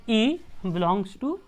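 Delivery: slow, word by word; slow dictation. Shown as a demonstration. Teacher shows students how to read the notation, slowly. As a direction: read "ई belongs to ए" slowly for the class. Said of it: "इसको कैसे पढ़ेंगे?".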